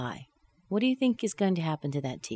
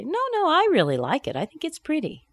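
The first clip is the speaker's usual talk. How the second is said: much higher-pitched objection; protesting disagreement; 11 semitones higher than usual. Acted. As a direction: insist politely in a lifted voice. Acted disagreeing reply.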